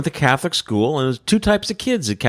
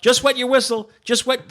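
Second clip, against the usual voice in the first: mock nun yelling; 10 semitones higher than usual. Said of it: "screaming at us".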